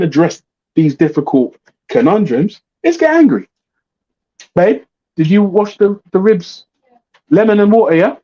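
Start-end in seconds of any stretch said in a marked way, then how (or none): 2.8-3.5 high, hammed-up sudden anger; mock the hotheads; a high, mocking "let's get angry". 4.5-8.1 calling out. Real, not acted. Calling to wife off-mic.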